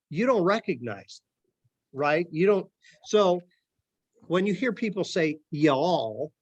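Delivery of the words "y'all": drawn-out Southern "Y'all", lifting at the end; Southern accent. Shown as a demonstration. Lecturer quoting how people talk down South, drawn-out Southern accent. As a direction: stretch it like a Southerner. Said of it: "they're from the South".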